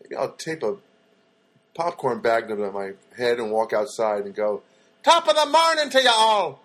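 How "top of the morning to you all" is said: hammed-up Irish accent, high and loud; a cheery stage Irish greeting, much higher and louder; Irish impression. Comedian doing a stage Irish greeting to everyone outside, hammed-up Irish accent. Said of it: "That's my Irish impression".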